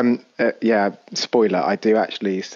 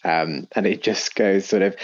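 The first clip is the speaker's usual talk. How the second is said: normal talking voice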